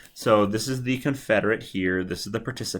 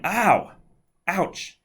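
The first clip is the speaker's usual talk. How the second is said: higher mock cries of pain; a hammed-up, pitched-up ouch; mock scream; 9 semitones higher than usual. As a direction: yelp like a shocked man. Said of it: "start to scream".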